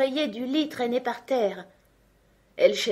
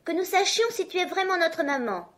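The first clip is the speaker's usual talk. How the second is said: put-on much higher kids' voice; kids crying out; 9 semitones higher than usual. A narrator's reading. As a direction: kids doubting the wolf. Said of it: "crièrent les chevreaux". (voix aiguë et méfiante des chevreaux)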